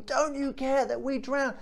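high-pitched mock shout, no real volume; strained, high retold plea; imitated panicked shouting. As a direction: act out desperate fishermen crying out, voice pitched right up. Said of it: "They would have been shouting".

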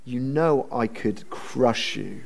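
low, soft slow threat; the godfather threatening softly; menacing. Retold or imitated. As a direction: drop the mask, quiet and slow. Laban's real threat.

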